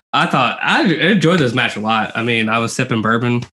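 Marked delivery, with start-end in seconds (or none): none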